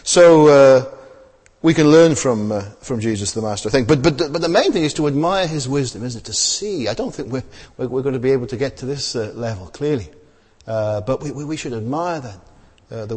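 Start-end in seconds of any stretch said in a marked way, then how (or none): none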